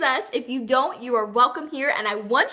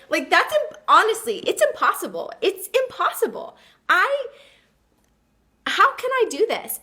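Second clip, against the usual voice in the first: much higher, pitched-up disbelief; incredulous; 7 semitones higher than usual. Real, not acted. Host stunned by her own impossible dream, voice up high. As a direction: marvel at how impossible it is.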